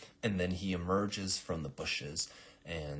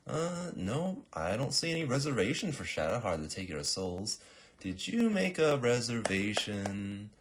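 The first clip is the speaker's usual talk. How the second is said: higher, mock-helpful clerk voice; officious receptionist; 5 semitones higher, 4 dB louder than usual. Girl scanning clipboard to assassin, officious singsong query.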